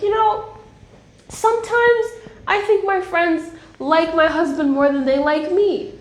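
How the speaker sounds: high, mock-whiny complaint; friend complaining. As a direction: imitate a friend grumbling playfully. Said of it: "because she's like".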